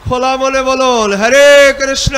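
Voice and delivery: drawn-out, loud, high call of হরে Krishna; loud open-hearted call. Shown as a demonstration. Monk urging devotees to chant without shyness, loud slow call. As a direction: sing it out slowly and boldly to lead the crowd. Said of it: "তাই লজ্জা না করে".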